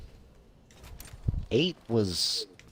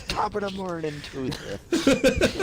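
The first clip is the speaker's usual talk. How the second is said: put-on high, slow, laughing Irish accent; hammed-up Irish greeting; 14 semitones higher, 5 dB louder, much slower than usual. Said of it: "some Irish fucker".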